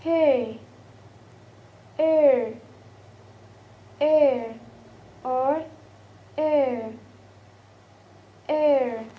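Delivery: "air" as a long falling glide, repeated with pauses; diphthong demo. Shown as a demonstration. Teacher showing the last diphthong, drawn-out demo. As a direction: model "air" slowly, as the vowel in hair. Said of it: "And the last is".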